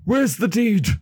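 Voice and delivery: high, urgent puppet voice; James alarmed. Acted. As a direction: snap the question out in sudden alarm. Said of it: "and then James goes".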